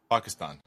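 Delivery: "Pakistan" with ah in the last syllable; "Pakistan" with an open ah at the end; Obama's pronunciation. Retold or imitated. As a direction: say "Pakistan" ending in "stahn". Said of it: "Obama, you say Pakistan".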